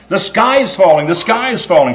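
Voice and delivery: high, loud panicked hen cry; hen shouting. Acted. Henny Penny shouts that the sky is falling, high and loud. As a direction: shout the warning like a frantic little hen. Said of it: "she started shouting".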